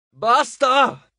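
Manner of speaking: high, loud outburst; exasperated yell; angry shout. A narrator's reading. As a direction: yell it, fed up. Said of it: "gridò".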